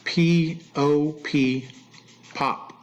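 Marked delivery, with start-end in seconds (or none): 0.0-1.7 letter by letter, P O P; spelling words out. Shown as a demonstration. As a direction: say P, O, P as separate letters. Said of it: "Why are you spelling words out?".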